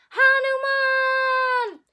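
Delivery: shout. Real, not acted. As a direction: stretch it out and shout the name to the sky. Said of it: "we're gonna shout Hanuman".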